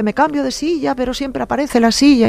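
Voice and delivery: mock-tearful little girl, pitched high; imitating herself crying as a child. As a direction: retell the girl's sobbing complaint in a high childlike voice. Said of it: "yo lloraba y yo decía".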